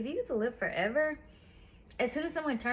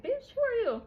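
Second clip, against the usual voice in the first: much higher, sassy dismissive question; snooty mentor; 12 semitones higher than usual. Acted. Mentor who forgot her asks who she is, high and sassy. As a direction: toss it off like a stranger brushing her away.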